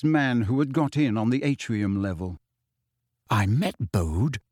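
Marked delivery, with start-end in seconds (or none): 3.3-4.4 slowly, weighing each word; slow remembering voice. A narrator's reading. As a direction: drag it out as it dawns on him. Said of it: "he said slowly".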